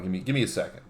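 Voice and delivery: ordinary delivery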